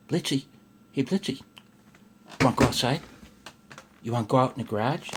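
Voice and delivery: high, sing-song voice for the dog; calling the dog. Real, not acted. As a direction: coax the dog to come outside. Calling his dog outside.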